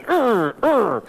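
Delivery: two short, high falling grunts; repeated pained grunts. Retold or imitated. Man recalling his repeated hammer mishaps, mock pained grunts. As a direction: re-enact repeated yelps from hammering your thumb. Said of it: "all the time I'm going".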